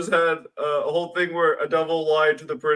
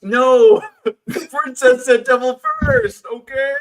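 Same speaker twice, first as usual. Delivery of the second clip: higher, louder laughing protest; excited protest; 7 semitones higher, 7 dB louder than usual. Real, not acted. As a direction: protest loudly through a laugh. Protesting loudly while laughing.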